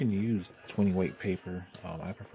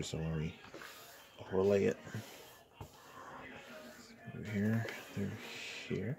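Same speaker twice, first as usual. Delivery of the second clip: normal talking voice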